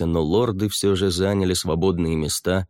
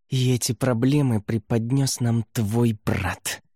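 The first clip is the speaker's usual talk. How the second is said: venomous hiss; 5 semitones higher than usual. Acted. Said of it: "ядовито прошипел".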